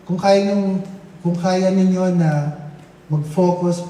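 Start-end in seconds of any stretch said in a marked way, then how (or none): none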